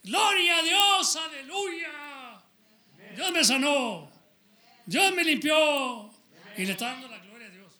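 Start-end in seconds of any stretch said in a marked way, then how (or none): 0.0-6.1 high, loud, slow jubilant shout; healed leper praising God in a great voice; excited praise shout. Retold or imitated. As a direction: shout your thanks to God at the top of your voice. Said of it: "Cuando uno se pone excited y dice".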